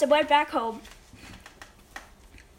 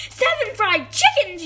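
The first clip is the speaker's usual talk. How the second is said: louder, higher outraged echo; mom yelling; 6 semitones higher, 5 dB louder than usual. A narrator's reading. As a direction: yell it back in disbelief. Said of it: "yelled his mom".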